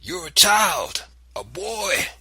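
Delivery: high, loud and slow; thundered. Acted. Grandfather rebuking the boy, loud and slow. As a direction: thunder it down at him. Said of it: "he thundered".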